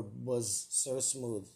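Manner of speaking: ordinary talk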